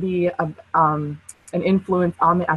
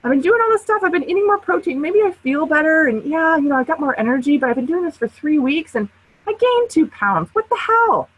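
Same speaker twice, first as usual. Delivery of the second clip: high, exasperated mock whine; much higher frustrated whine building to a yelp; frustrated client; 8 semitones higher than usual. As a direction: play the fed-up dieter, voice pitched way up. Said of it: "easy to get frustrated with yourself to say".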